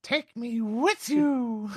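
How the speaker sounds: high, slow, pleading plea to be taken along; hammed-up pleading line. Podcast friend performs the requested line in a high, slow plea. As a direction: stretch it out in a high begging voice. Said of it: "Say the line, [name]".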